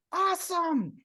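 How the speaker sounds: retold happy yell. Swimmer answering the instructor, mock yell. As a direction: call it out high without full volume. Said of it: "And I yelled".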